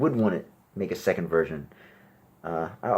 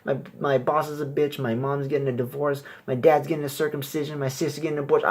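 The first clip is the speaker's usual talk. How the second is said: put-on higher complaining girl; whiny girl voice; 8 semitones higher than usual. Girl at a bar unloading her problems, higher and whiny. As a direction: list the gripes in a whiny high voice. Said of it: "she's gonna be like".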